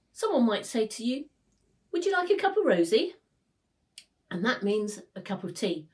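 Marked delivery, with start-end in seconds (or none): 1.9-3.1 chirpy Cockney quote. Retold or imitated. Quoting a Cockney offering tea.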